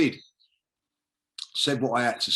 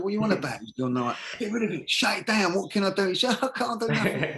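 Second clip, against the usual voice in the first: high, frantic retold panic from the mate; panicky mate; 10 semitones higher than usual. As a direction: squeak it out like a friend losing his head. Tony panicking, phone going viral.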